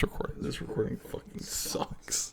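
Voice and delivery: hushed whispered aside; soft whispered grumble. Real, not acted. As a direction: mutter it under your breath. Whispered self-deprecation.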